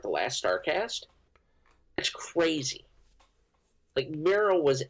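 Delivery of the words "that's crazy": whispered aside. Real, not acted. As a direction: breathe it out.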